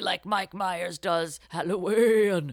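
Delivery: slow, airy ghostly drawl. Acted. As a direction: float it out like a spooky ghost. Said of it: "That was my ghost voice".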